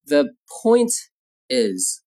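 slow, with a pause before the last word; slow model phrase. Shown as a demonstration. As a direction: say it in separate pieces for learners.

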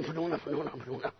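very low, soft repeated mumble; low voice fading out; hushed giving-up. Acted. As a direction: let it sink away quietly. Horse is done for, soft aside.

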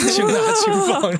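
can't keep a straight face repeating it; laughing through the quote. Real, not acted. Retelling guard's words, laughing. (笑着复述)

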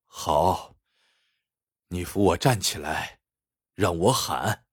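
low, subdued agreement; injured captive giving in. A narrator's reading. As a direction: agree quietly and low, asking to be helped up.

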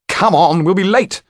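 high, loud hurrying call; impatient magician. A narrator's reading. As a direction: chivvy them out of the door. Topolan hurries the others along.

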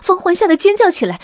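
high, loud, alarmed storytelling; urgent narration. A narrator's reading. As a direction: narrate as if the panic were catching. Narrating panic at the rope. (高声紧张地讲述)

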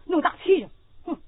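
ordinary delivery

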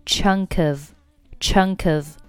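"chunk of" joined as "chun-kof", slow; linked chunk of. Shown as a demonstration. Teacher drilling the link in "chunk of". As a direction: run "chunk" straight into "of". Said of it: "chunk of连读".

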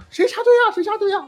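shrill mock denial. Jumpers pointing and denying, very high mock voice. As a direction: shriek who cut the line in a put-on voice. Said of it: "然后那俩人就指着".

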